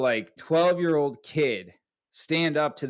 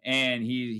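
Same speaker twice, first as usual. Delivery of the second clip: ordinary talk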